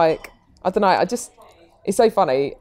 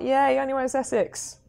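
put-on Essex accent; 7 semitones higher than usual.

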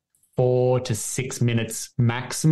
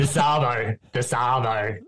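Australian "arvo", repeated for a learner; Australian accent, teaching the word; slower than usual. Shown as a demonstration. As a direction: model "arvo" in an everyday Aussie accent. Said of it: "in your, your accent".

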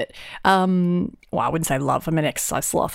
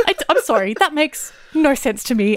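a touch higher, exasperated confusion; mock bewildered woman; 4 semitones higher, faster than usual. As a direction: lightly play the baffled student. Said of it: "This poor woman was like".

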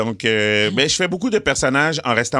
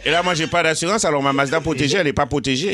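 put-on higher-pitched, comic Haitian-accented complaint; 7 semitones higher than usual. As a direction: plead your case in a Haitian accent. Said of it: "jaser avec un accent haïtien ou en créole". (accent haïtien aigu, plainte comique)

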